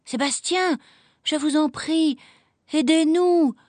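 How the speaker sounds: pleading. A narrator's reading. Clara begging the valet to hide the kittens, high plea. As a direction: beg the valet, rising in pitch. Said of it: "supplia Clara".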